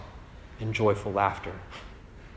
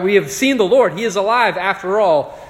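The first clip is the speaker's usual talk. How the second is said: higher, louder thrilled announcement; excited friends; 7 semitones higher, 8 dB louder than usual. Retold or imitated. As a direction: shout the good news. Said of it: "his friends find him and they're like".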